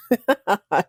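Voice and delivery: short amused laugh; a quick real chuckle. Real, not acted. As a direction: burst out laughing at your own joke. Laughs at own joke.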